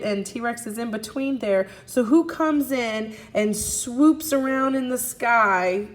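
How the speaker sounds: ordinary talk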